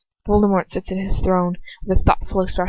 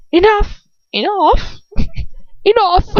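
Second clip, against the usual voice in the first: high, loud commanding bark; mock villain roar breaking into laughs; Voldemort shout; 11 semitones higher, 3 dB louder than usual. Reading Voldemort halting Lucius, loud and giggly. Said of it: "That's what his voice sounds like".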